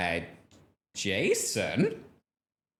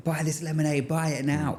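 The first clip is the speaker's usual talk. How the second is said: higher-pitched mock child hustling a sale; hammed-up little lemonade seller; pushy kid seller; 8 semitones higher, much faster than usual. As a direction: imitate a kid demanding a purchase. Kids selling lemonade to parents.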